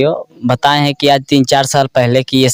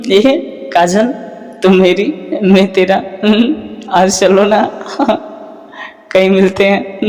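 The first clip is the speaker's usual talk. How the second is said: high romantic film-hero voice; Shah Rukh Khan impression; 7 semitones higher than usual. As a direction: swoon it like a Bollywood hero. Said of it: "Shahrukh Khan का आवाज़".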